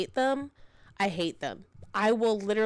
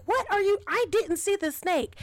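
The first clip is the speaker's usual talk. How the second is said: high, loud retold protest; flustered, pitched-up mock protest; indignant denial; 12 semitones higher, 3 dB louder than usual. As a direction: imitate a baffled husband defending himself. Acted husband's indignant denial.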